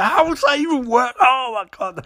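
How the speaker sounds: high-pitched frantic protest; mock panic. Barry hearing of the defibrillator, squealing in mock panic. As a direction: squeal it in hammed-up distress.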